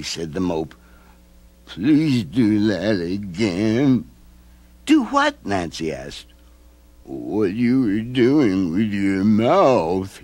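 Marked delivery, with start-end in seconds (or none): 1.6-4.0 slow, odd gloomy creature; mope voice. A narrator's reading. Mope asking Nancy to smile again, slow. 4.8-5.3 put-on high little girl. A narrator's reading. Nancy reassuring the old man, high girl voice. 7.0-10.2 slow, odd gloomy creature; mope voice. A narrator's reading. Mope asking Nancy to smile again, slow.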